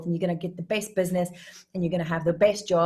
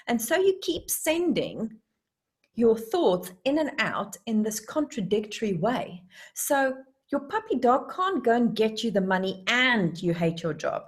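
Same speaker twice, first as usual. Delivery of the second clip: ordinary talk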